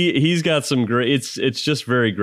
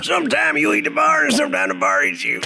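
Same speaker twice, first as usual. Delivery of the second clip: high, far off cowboy line; a squeaky take on the wise cowboy; high mock cowboy wisdom; Sam Elliott impression; 12 semitones higher than usual. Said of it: "Oh, Sam Elliott?".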